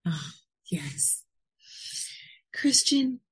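normal talking voice

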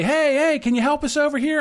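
high-pitched call to come over; calling out for help. Retold or imitated. Drunk man's friends hail a passer-by for help, calling out. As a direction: imitate someone hailing a helper.

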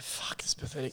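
a hushed sneer at dad's bad photos; whispered scorn. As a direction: whisper it with contempt.

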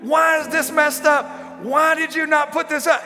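put-on high, loud exasperated shouting at kids; imitating angry parent. Preacher imitating herself yelling why at her kids. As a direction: yell at your children like a fed-up parent. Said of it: "but I'm like".

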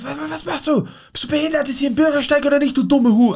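retold furious rant. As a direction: rant at the driver who nearly ran him over. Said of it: "Und dann bin ich kurz ausgerastet". (nacherzählter Wutausbruch, viel höher und aufgebracht)

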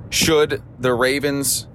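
ordinary delivery

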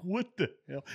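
high, loud mock disbelief from the ranks; incredulous troops. Troops shocked by a surprise inspection, high incredulous yelp. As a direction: play the stunned Marines blurting it out.

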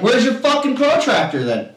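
high-pitched sarcastic challenge; retold irritation. Player demands a protractor from opponents, irritated. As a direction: demand proof, exasperated. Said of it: "really getting irritated".

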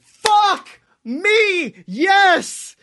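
high, excited yelp of delight; thrilled outburst. Real, not acted. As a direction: burst out in sheer glee. Thrilled at the final fight idea.

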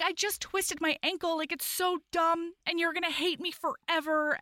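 high, mildly whiny imitation of crying; tearful. Injured heroine confessing to him, mock tearful high voice. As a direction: voice her woe high, without real sobs. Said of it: "like, she's crying".

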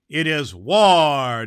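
loud, slow proclamation; declaring war. A narrator's reading. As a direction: thunder it out slowly.

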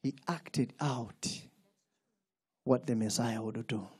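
hushed teaching. Real, not acted. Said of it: "quiet teaching passage".

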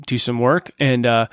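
ordinary delivery

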